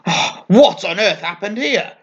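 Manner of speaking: put-on old witch voice, high and wailing in dismay; despairing cry. A narrator's reading. Old witch finding the wrecked storeroom, despairing cry. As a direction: throw up your hands at the mess. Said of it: "cried Red Mary as she pulled her hat over her face in despair".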